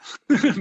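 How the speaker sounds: a quick real laugh, pitch jumping up; real bright laugh. Real, not acted. Guest laughs at having cut off the students, a quick real laugh. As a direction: laugh off the interruption.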